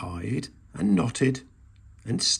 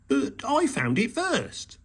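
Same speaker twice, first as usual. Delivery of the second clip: huffy; 12 semitones higher, slower than usual. A narrator's reading. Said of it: "huffed Hedgehog".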